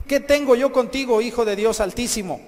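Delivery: loud, high-pitched acted challenge; angry outcry. Possessed man challenges Jesus, angry outcry. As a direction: hurl it at Jesus as an angry accusation. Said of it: "Estaba enojado".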